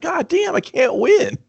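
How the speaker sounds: high, strained cry of defeat; exasperated outburst. Retold or imitated. Mocked teen hero cries out to himself, high and defeated.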